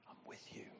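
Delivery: breathy whisper, all air; whispered promise. Acted. Preacher whispering God's promise to the church.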